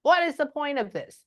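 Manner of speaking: high-pitched exasperated mock yell; relayed outburst, pitched well up without full shouting; frustrated mock yelling. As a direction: imitate someone crying out in frustration, voice lifted. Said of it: "she's yelling at everybody".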